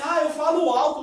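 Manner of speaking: loud self-aware admission; loud half-joking confession; loud talk. Real, not acted. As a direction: say it loudly, owning it. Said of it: "Não, não falo alto".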